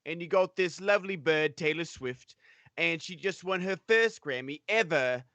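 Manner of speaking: put-on a lightly done Cockney British accent. To the stream, telling the feud in a British accent. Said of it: "your quote-unquote British accent".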